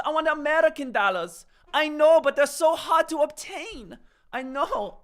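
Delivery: high, loud, hammed-up Irish accent; attempted Irish accent. Shown as a demonstration. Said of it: "give us your best Irish accent".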